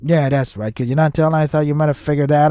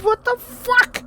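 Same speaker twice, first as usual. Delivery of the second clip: high, incredulous disbelief; exasperated outburst; 13 semitones higher, 3 dB louder than usual. Real, not acted. Reader ranting to viewers about the romance, exasperated. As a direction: rant at the absurd story, much higher.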